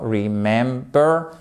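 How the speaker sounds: "Remember" very slowly in three syllables, stress on MEM; syllable-by-syllable stress demo. Shown as a demonstration. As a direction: break "Remember" into re-MEM-ber, drawn out. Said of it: "puisque vous en avez trois". (« Remember » lentement, syllabe par syllabe, accent sur MEM)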